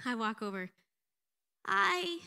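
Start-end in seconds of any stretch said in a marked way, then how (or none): 1.7-2.2 high-pitched, pathetic little greeting; mousy, pathetic. Retold or imitated. Awkward woman greeting an older stranger, mousy and pathetic. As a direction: imitate a mousy, awkward hello. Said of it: "Like the most mousiest, pathetic hi".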